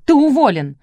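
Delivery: loud barked firing. A narrator's reading. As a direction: bark it. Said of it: "рявкнула Элла".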